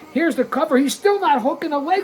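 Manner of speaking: excited call. Real, not acted. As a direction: sell the near fall with rising alarm. Frustrated at missed leg hook.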